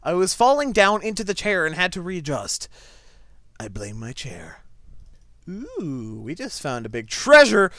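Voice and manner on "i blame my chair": normal talking voice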